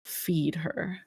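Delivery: soft, eerie chant from many mouths; hive voice muttering. Acted. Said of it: "hive voice just muttering".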